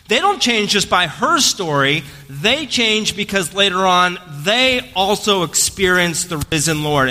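ordinary talk, nothing marked

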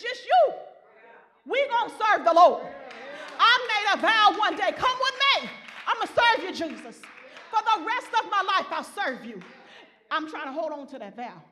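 loud, high, building preacher's cry; preaching at full force. Real, not acted. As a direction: lift the church, shout it out.

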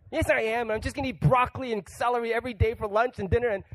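high, insistent mock protest; defiant insistence. Mock-defiant resolution maker arguing back with himself. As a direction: insist on the resolution like a stubborn kid.

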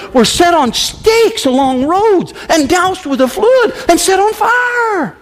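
climbing to a preached shout; rising shout. Real, not acted. Preacher crying out the martyrs' fate, climbing shout. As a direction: build to a passionate cry.

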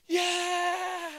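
high, loud whoop of joy; celebratory yell. Acted. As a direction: cheer like a fan after the winning shot. Acting fans' celebration.

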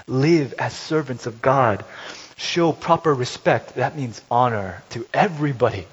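loud, slow proclamation of verses; preacher reading scripture, loud; forceful scripture reading. Acted. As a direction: declaim the passage to the congregation.

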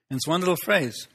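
ordinary delivery

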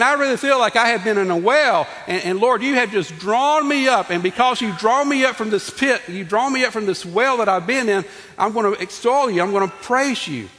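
impassioned sermon delivery; fervent preaching. Acted. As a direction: preach it out with rising fervor. Preaching David's prayer aloud.

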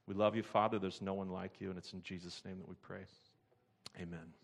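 closing prayer, softening toward the amen. Acted.

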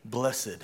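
Blessed said softly as BLESS-ed; "Blessed" with the -ed sounded; two-syllable Blessed. Shown as a demonstration. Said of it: "why we say blessed".

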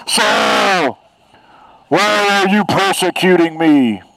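booming divine voice from the sky; God voice. Acted. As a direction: thunder it down from heaven. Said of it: "That's my God voice".

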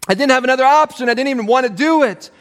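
hurried guilty protest; rushed mock excuse. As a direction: blurt the excuse quickly.

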